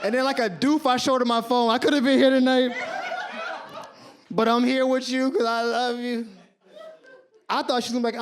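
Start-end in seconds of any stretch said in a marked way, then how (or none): 1.7-6.3 tearful guilt trip, hammed up; mock crying. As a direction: wail it like a wronged martyr. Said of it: "and I'm crying".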